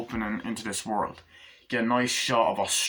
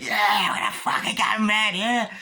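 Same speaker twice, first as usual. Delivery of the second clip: much higher, louder hyped-up crazed yell; wild thug whoop; 11 semitones higher, 5 dB louder than usual. Acted. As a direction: whoop it up like a maniac behind the wheel. Thug hyping his girl in car.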